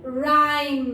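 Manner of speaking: राइम with a held "eye" vowel; long I demo. Shown as a demonstration. Teacher reading the word for pupils, राइम with long I. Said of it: "आई की long sound दे रहा है".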